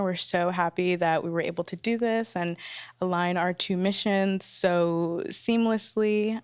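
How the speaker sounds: ordinary talk, nothing marked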